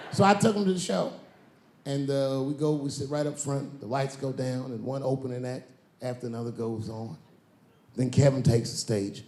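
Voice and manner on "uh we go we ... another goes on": normal talking voice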